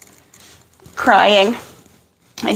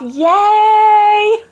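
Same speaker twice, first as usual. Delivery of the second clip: excited; 10 semitones higher than usual. Real, not acted. Cheering a day off.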